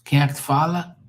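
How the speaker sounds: a hint of someone picking up the phone; phone answer. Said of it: "when somebody answers the phone".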